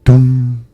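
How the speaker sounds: loud, booming "dong" held on one note; clock chime. A narrator's reading. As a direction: imitate a striking clock, ringing out the hum. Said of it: "rung the clock".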